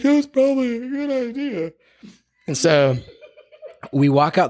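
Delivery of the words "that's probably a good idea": high, whimpering imitation of the client; hammed-up tearful voice; mock tearful. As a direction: imitate her weepy agreement, pitched way up. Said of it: "sobbing and crazy and crying".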